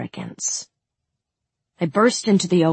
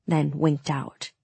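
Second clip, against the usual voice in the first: plain reading voice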